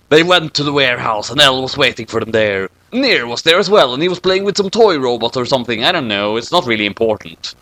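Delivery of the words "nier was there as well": ordinary delivery, nothing marked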